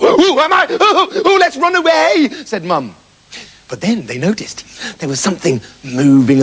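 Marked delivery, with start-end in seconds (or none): 0.0-2.3 hammed-up fright, ready to flee; startled mother. Mother realises she's on wolf.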